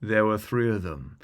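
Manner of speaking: ordinary delivery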